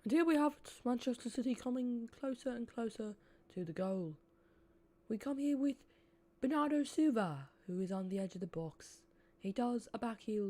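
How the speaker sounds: high-pitched put-on commentary voice; mock match commentator. Commentator following Manchester City toward goal, in a high mock-commentary voice.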